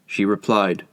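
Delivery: usual speaking voice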